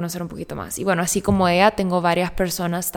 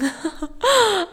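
embarrassed laugh; 13 semitones higher than usual. Real, not acted. Laughs after her own yawn.

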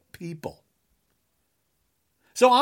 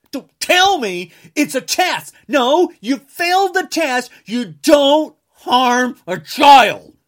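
higher, louder, slowing to a hammered final line; angry yelling; 5 semitones higher, 7 dB louder, slower than usual. Real, not acted. Ranting at people who harm children, angry yell. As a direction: shout it down at them, word by word.

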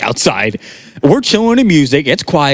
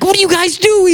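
annoyed friend. Retold or imitated. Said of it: "He's like".

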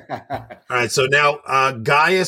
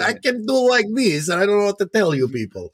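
higher-pitched put-on Russian accent; hammed-up fake Russian accent; bad Russian accent; 6 semitones higher than usual. Shown as a demonstration. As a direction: show off a mock Russian accent. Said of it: "That is my Russian accent".

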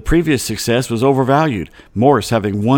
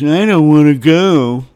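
mock whiny quote; 4 semitones higher, 6 dB louder, slower than usual. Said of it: "after Stephen Curry says".

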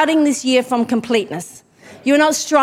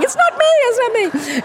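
much higher squeaky protest, imitating Rachel; mock protest; 11 semitones higher than usual. Rachel in the congregation protesting her name, high mock denial. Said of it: "Rachel's, like, going".

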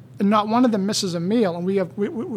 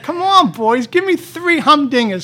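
louder, higher taunting challenge; goading; 6 semitones higher, 7 dB louder, slower than usual. Retold or imitated. Taunting the speechless doctors, loud and goading. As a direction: needle them to answer.

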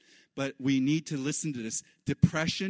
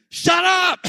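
much higher, much louder yell; yelling down the haunting voice of depression; defiant shout; 14 dB louder than usual. Retold or imitated. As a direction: scream it at the accusing voice. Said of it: "basically you're saying".

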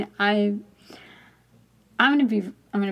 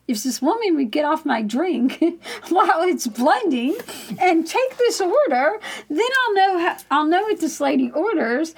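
insistent bargaining; 7 semitones higher than usual. Retold or imitated. Customer bargaining with the voice in her head, insistent. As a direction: lay out the conditions with animated, high-pitched insistence.

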